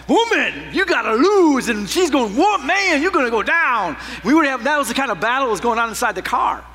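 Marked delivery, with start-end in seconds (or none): 0.1-1.7 raised-voice husband picking a fight; mock-combative challenge; mock quarrel. Acted car quarrel with wife. 2.4-4.0 high, loud imitation of an arguing wife; exaggerated wife's comeback; mock quarrel. Acted wife arguing back.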